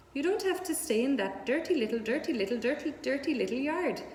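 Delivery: a goose tripping over her repeated words; fast-talking goose. Acted. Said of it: "who talked rather fast".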